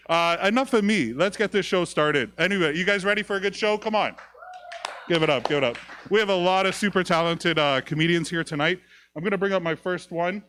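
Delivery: loud host hyping the crowd; announcer hype. Acted. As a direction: pump up the crowd like an MC.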